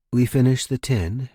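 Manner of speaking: plain reading voice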